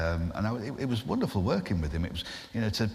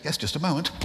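higher, polite receptionist voice; switchboard operator; 6 semitones higher than usual. Retold or imitated. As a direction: answer politely and put the call through.

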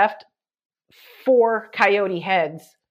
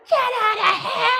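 high yell to scare them off; yelling at coyotes; faster than usual. Retold or imitated. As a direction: bark them away, pitched high. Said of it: "like, yell".

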